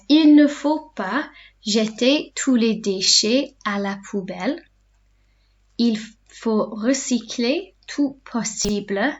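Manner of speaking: slow, careful dictation pace; slow classroom repetition; said slowly. Shown as a demonstration. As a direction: spell it out slowly for learners. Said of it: "say them slowly in between".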